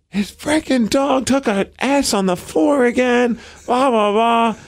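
half-hearted imitation of a coworker flipping out; retold angry yell. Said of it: "I hear B J yelling".

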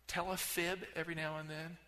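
normal talking voice

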